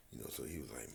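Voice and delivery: usual speaking voice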